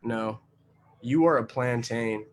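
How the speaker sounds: ordinary talk, nothing marked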